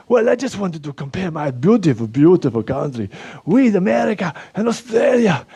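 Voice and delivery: put-on a proud Eastern European accent, voice pushed up high; Slovak accent. [name] gloats about Slovakia's covid numbers in a high Slovak accent. [name] tells Stefan what he did.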